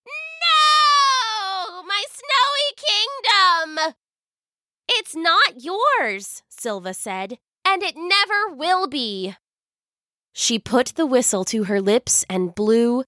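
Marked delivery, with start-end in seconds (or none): 0.1-3.9 shrieking witch, furious and high; raging scream. Acted. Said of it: "she screamed with rage". 4.9-9.3 put-on young girl voice. Silva defies the witch.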